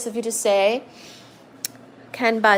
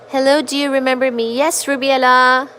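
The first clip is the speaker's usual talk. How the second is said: ordinary delivery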